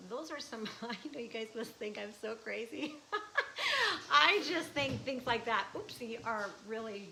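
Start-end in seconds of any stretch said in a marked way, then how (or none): 3.0-4.1 high self-conscious laugh. Real, not acted. Laughing at herself on camera.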